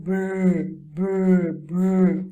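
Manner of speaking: gentle "brr" sound demo. Shown as a demonstration. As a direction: model "brr" slowly and clearly for children. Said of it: "you're going to make the sound".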